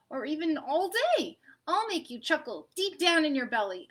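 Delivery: lightly voiced eager child asking to play; eager kid voice. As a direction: pitch it up like a keen little kid. Peanut Butter greets French Fries.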